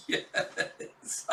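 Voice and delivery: word squeezed out through laughter; real laughing. Real, not acted. Host delighted by the scene, laughing. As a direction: get it out mid-laugh.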